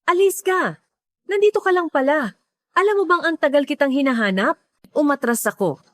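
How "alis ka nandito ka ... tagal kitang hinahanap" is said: raised, higher-pitched accusing call; mild shout. A narrator's reading. Ex-husband confronts the woman he tracked down, mildly shouting. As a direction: read as a restrained shout, pitched up. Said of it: "sumigaw sa Czech".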